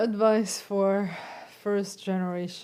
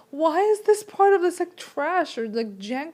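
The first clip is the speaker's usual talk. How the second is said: exasperated self-talk; 7 semitones higher, 5 dB louder than usual. Retold or imitated. Frustrated inner voice retold.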